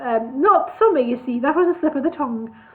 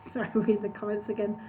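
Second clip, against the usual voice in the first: laughing aside; 2 semitones lower, 11 dB quieter, faster than usual. Real, not acted.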